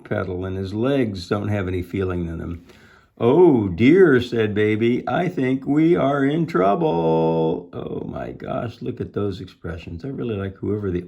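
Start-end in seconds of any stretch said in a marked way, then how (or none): 3.2-7.7 loud drawn-out dismay; worried Baby. A narrator's reading. Baby realizing they are in trouble, acted dismay. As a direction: stretch it out, rising as trouble dawns.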